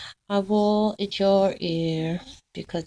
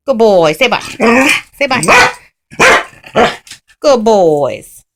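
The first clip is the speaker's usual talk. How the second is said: loud praise; 13 dB louder, slower than usual. Real, not acted.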